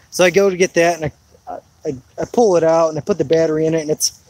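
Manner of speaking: usual speaking voice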